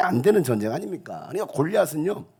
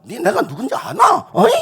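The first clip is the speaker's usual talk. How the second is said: higher, louder thug bluster; tough gangster swagger; 10 semitones higher, 9 dB louder than usual. Pretend. Pastor acting a believer's swagger, loud mock thug. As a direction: puff up like a street tough picking a fight. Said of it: "하면서".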